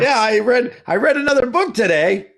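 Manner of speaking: mock-proud imitation of a friend; mocking Curtis. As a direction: brag it like a proud reader. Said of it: "when Curtis is saying".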